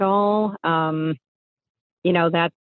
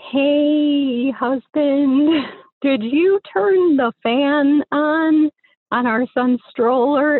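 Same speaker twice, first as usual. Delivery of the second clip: high worried question; 8 semitones higher, much slower than usual. Retold or imitated. Wife asking husband if he turned the fan on, high and slow. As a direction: call out to her husband, uneasy and slow.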